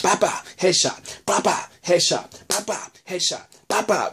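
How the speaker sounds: playful bah bah sound effects, slowed; mock gunshot noises. Describing Daryl's headshots, mock sound effects. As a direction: punch out cartoon kill sounds. Acting out Daryl's knife kills.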